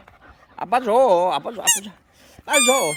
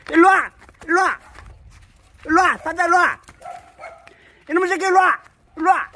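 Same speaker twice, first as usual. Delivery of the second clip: loud call to the dog; 11 semitones higher, 5 dB louder, faster than usual. Real, not acted. Owner calling his dog loudly. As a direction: call the dog's name across the yard.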